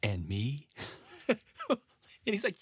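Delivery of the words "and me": low, small mock plea; a lightly voiced needy grandpa; whiny Grandpa Joe. Grandpa butting in for himself, low and whiny. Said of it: "He goes".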